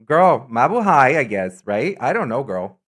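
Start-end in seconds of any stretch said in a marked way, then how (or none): none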